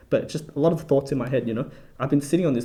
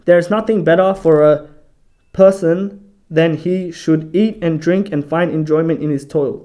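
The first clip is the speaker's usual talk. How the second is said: louder, measured reading aloud; scripture reading; 6 dB louder than usual. Acted. Bible study host reading a verse to listeners, scripture reading voice. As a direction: read the verse out firmly and deliberately.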